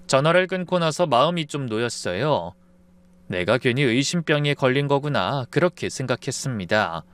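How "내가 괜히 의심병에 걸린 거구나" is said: plain reading voice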